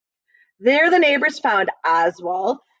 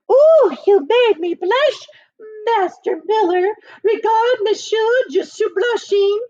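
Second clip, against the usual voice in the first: high, slow, simpering lady's voice; prissy nun fluttering with mock modesty; high put-on genteel gush with French; affected prioress; 12 semitones higher, 4 dB louder than usual. A narrator's reading. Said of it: "she exclaimed".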